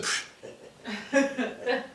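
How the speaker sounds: short puff of a laugh, then laughing; surprised laugh. Retold or imitated. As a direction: burst out laughing in disbelief. Laughing reply to the guide.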